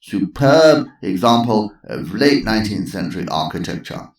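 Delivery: Sir Lancelot. Acted. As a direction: relish each feature.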